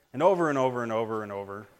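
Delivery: rattled off fast in a low drone; tedious repetition. Retold or imitated. Parent weary of kids' CDs in the car, droning repetition. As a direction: run the words together, low and quick. Mimics endlessly repeating car CD.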